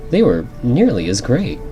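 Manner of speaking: ordinary delivery, nothing marked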